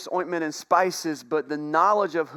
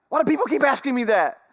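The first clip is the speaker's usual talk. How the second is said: exasperated; 8 semitones higher, 5 dB louder, faster than usual. Retold or imitated. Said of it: "And he asked her".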